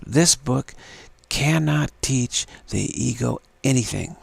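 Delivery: "cannot" stressed firmly, each word set apart; adamant. Shown as a demonstration. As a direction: insist on "cannot", unshakable. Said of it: "you can be very adamant. Say this".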